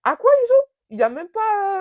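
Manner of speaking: very high, loud incredulous squeal; shocked disbelief. Retold or imitated. Her stunned reaction to his outburst, high loud disbelief. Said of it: "Un peu choquée, je suis en mode".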